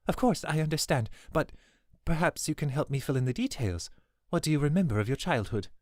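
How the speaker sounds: a nervous former housekeeper explaining herself; the old servant pleading her case; anxious older servant. A narrator's reading. Swidona presses Allie gently.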